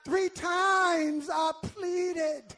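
drawn-out, rising sermon shout; preaching climax. Acted. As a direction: stretch each "three times" like a cry from the pulpit.

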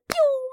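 high loud mock gunshot pew; the slide drop as a gunshot noise; whoosh sound effect. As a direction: fire off a sudden high pew.

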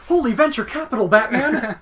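fast, high, loud sidekick cry; Robin-style exclamation. Pretend. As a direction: rattle it off like Batman's sidekick. Robin catchphrase parody.

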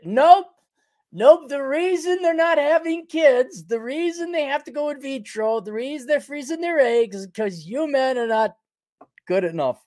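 mock their excuse with scorn; mocking nope. Ranting mock women's answer.

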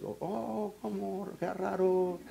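very soft, feigned puzzlement; puzzled aside. Retold or imitated. Pastor reacting to the market excuse, quietly puzzled. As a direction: murmur it as a mock-baffled aside.

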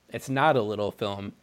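ordinary delivery

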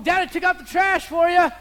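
put-on high, loud kid calling out; proud kid shout. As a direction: proudly yell it like an eager kid. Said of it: "I come home".

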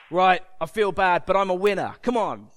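loud, mock self-motivation; mirror pep talk. Someone giving themselves a pep talk in the mirror, mock-rousing. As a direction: psych yourself up loudly, in parody. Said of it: "give yourself a little pep talk".

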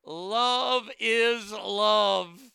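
loud, high, very drawn-out mock declaration; mock proclamation. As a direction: proclaim it grandly, stretching every word slowly. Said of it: "He went on to say".

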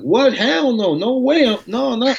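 high-pitched, alarmed protest; incredulous refusal. Retold or imitated. Racer balking at friends' tire pressure advice, incredulous.

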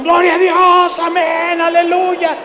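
very high, loud chorus of praise; crowd shouting praise. Retold or imitated. The congregation shouting praise at the trick question, very high and loud. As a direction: cheer without listening.

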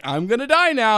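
high, loud mock last report; doomed newscaster. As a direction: announce your doom as a hammed-up reporter. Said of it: "first guy in the first movie is like".

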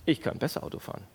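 quick, whispered self-praise; smug whisper. Shown as a demonstration. Pastor voicing a secret smug thought. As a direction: whisper it to yourself, pleased. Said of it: "Kennt ihr das?".

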